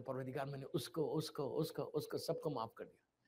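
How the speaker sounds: soft, low intimate prayer; hushed prayer. Shown as a demonstration. The preacher models a quiet prayer forgiving everyone. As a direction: pray it softly to God.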